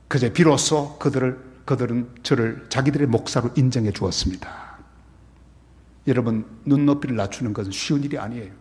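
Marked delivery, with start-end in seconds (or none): none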